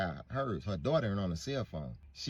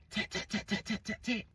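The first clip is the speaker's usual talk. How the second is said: tapping imitation; 12 semitones higher than usual. Imitating tap at window.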